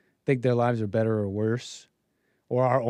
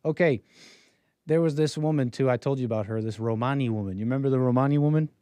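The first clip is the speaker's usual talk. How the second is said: ordinary delivery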